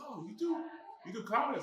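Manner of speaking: high, surprised question; surprised friend. Retold or imitated. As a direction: act out the friend's amazement.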